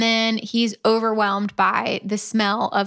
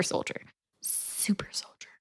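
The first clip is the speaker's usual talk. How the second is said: whisper; 14 dB quieter than usual. Real, not acted. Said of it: "repeating word, whispered".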